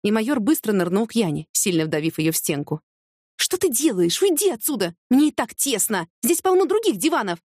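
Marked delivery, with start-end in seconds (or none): 3.4-7.4 high-pitched indignant complaint; indignant protest. A narrator's reading. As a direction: protest at being squeezed, pitch jumping up. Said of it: "возмутилась она". (возмущённо, высоким голосом)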